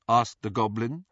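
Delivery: usual speaking voice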